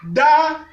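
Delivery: Da on a high, level, loud tone. Shown as a demonstration. Teacher reading syllables, Da on high tone. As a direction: hold Da high and even. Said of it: "Ça donne ceci".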